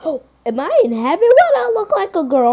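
gasping, shrill what; shocked at the new body. Pretend. Waking up transformed, shocked.

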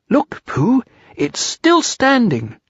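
high small creature voice; high little storybook piglet; eager Piglet. A narrator's reading. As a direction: point it out in a high, drawn-out pipe. Narrator voices Piglet exclaiming.

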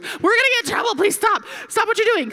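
high, frantic mock pleading; hammed-up panicked shout. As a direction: beg in a rising panic. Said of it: "then I'm panicked".

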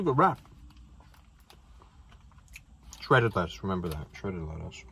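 speaking between bites; chewing while talking. Real, not acted. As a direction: mouth partly full of food. Seen 3: bit wrap at -3.0, still chewing, wrap in hand.

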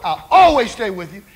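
loud, insistent pledge; preacher voicing Peter's bold promise; emphatic vow. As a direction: swear loyalty out loud, hitting it hard. Peter rebukes Jesus with a vow.

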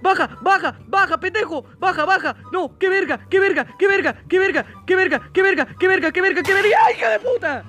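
high frantic shrieks, repeated over and over; panicked screaming. Real, not acted. Gamer fleeing the killer girl, shrieking in panic. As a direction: lose it completely, real terror.

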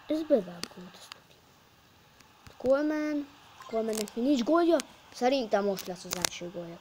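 normal talking voice

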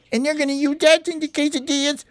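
high, alarmed cartoon squeal; acted alarm. Parakeet warns friends about the missing chicken, high-pitched alarm. Parakeet reveals they will be cooked.

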